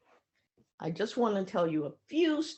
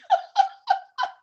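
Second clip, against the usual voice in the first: high burst of laughter; 6 dB louder than usual. Real, not acted. Laughs at retold quarrel.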